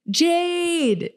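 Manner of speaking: reading out a fan's all-caps Jade, excited. Retold or imitated. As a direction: read it like it's shouted in capitals. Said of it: "in all caps".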